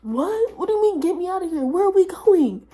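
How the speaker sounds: high, loud reenacted freak-out; panicked confusion. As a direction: squeal questions in mock panic. Retold panicked reaction to friends.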